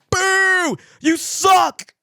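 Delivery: mock booing. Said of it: "they were just like".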